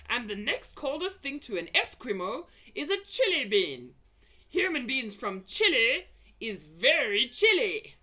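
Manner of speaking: gloating giant. A narrator's reading. Blood bottler boasting of his hunt, gloating. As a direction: gloat and tease.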